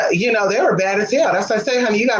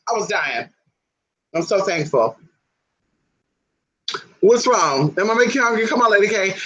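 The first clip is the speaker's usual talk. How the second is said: ordinary talk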